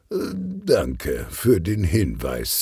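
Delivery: slowly, hesitant and caught out; drawn-out embarrassed thanks; slow sheepish thanks. Acted. Inspector thanks Sonja for the tie hint, slow and sheepish.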